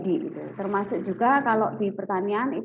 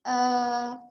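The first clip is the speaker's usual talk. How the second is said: choppy audio cutting out; bad signal; much slower than usual. Real, not acted. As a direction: voice dropping out over bad connection. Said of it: "Bu, suaranya nggak ada".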